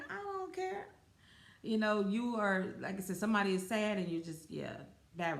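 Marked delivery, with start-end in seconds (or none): 0.1-0.9 high, soft dismissive voice; mock uncaring. Acting out someone with no sympathy, mock uncaring. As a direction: brush it off in a small high voice.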